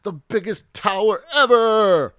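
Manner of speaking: huge high boastful shout; loud triumphant show-off yell. A narrator's reading. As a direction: crow it across the yard, stretching the end. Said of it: "he shouted".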